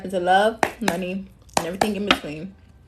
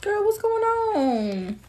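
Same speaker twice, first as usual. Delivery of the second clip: drawn-out surprise; 13 semitones higher, much slower than usual. Real, not acted. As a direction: stretch it out in disbelief. Reacts to card, drawn out.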